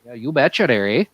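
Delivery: hammed-up Yooper Wisconsin accent, "you betcha there, eh?". Bragging about deer camp to friends, Yooper accent. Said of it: "trying to do more Yooper or more Canadian".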